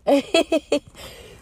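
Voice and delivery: a quick burst of laughter; short amused laugh; bright laugh. Real, not acted. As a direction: laugh out at yourself. Laughing at her own remark.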